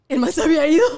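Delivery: entre risas al contar; riendo. Real, not acted. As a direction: se le escapa la risa mientras cuenta. Cuenta anécdota riendo. (riéndose de su propia anécdota)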